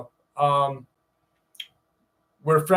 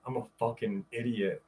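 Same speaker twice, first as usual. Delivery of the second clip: usual speaking voice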